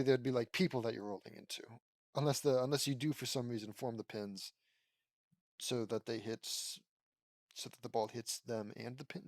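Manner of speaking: ordinary talk, nothing marked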